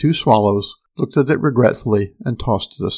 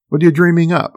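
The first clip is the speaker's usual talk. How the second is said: higher and louder, worried and pressing; anxiously pushing the crewman for the truth; anxious demand; 6 semitones higher, 5 dB louder, faster than usual. A narrator's reading. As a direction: demand an answer, fearing the worst. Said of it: "I demanded anxiously".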